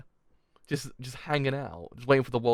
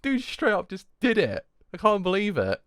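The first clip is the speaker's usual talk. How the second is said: high-pitched, giddy amazement; amazed disbelief; 7 semitones higher than usual. Real, not acted. Fan marvels at the character's trick, amazed. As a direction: marvel at it, pitched up after laughing.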